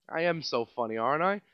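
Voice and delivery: ordinary talk, nothing marked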